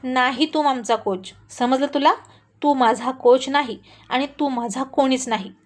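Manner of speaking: usual speaking voice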